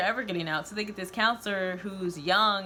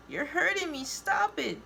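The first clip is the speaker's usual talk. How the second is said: high-pitched pained whine; whining; 9 semitones higher than usual. A narrator's reading. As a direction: whine it in a much higher voice. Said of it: "whines Kale".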